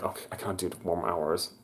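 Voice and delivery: soft, fast despairing mutter under the breath. Retold or imitated. Worker at breaking point muttering to herself, soft and fast. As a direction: mumble it like you're at the end of your rope. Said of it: "I was like".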